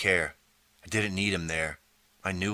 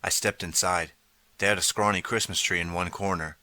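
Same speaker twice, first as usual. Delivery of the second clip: ordinary delivery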